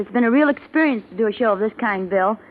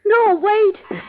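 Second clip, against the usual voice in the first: desperate plea; 11 semitones higher than usual. Acted. Husband says goodbye before dying.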